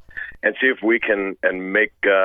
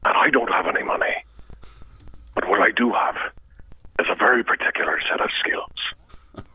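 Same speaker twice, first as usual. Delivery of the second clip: Taken monologue voice. Acted. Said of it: "that entire monologue memorized".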